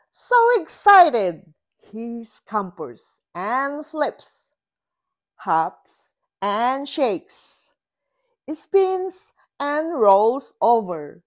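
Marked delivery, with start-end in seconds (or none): 0.1-9.3 loud, high excited narration. A narrator's reading. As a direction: build it up with excitement.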